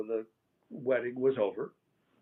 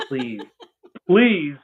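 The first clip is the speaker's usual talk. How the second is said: a real laugh breaking into the word please; 12 dB louder than usual. Real, not acted. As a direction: laugh through the repeated please. Laughing while retelling the request.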